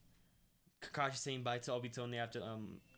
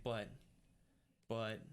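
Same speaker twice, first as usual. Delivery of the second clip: "But", long pause, then a lower "but"; hesitant quiet quote; 3 semitones lower than usual. Retold or imitated. Retelling why Naruto paused, hesitant and halting. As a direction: falter on "But" and leave a gap. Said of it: "that's why he was kinda quiet".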